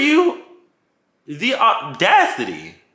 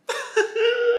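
high tearful laugh; 3 dB quieter than usual. Real, not acted. After her confession.

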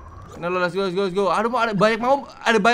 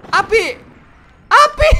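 panicked yelling; 7 semitones higher, 10 dB louder than usual. Real, not acted. Losing his fire power.

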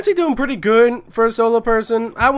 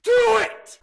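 exasperated yelp. Real, not acted. Reluctant outburst at friend.

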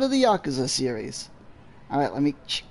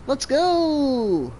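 hyped shout; 10 semitones higher, 5 dB louder than usual. Real, not acted. Heading out, hyped.

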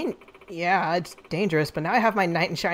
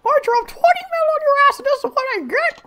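very high, loud cartoon squawk; hammed-up wail of a dumped stalker; silly stalker voice; 6 dB louder than usual. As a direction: squawk it like a jilted cartoon duck. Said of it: "I couldn't think of any other voice".

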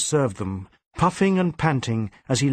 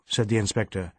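usual speaking voice

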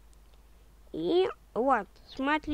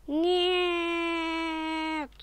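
crying out. Acted. Falls, cries out in game.

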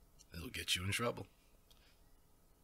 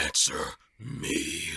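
slow, louder command after a sigh; cold demand; 6 dB louder, much slower than usual. Acted. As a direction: force the answer out of her, slow and heavy. Villain demands a reply.

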